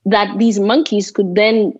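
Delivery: normal talking voice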